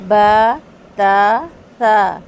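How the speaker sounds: با تا سا with jaw opened downward, thin and light; thin letters. Shown as a demonstration. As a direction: keep با تا سا light, mouth flat. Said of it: "میرا منہ نیچے کی طرف کھلا". (باریک حروف، منہ نیچے کھول کر)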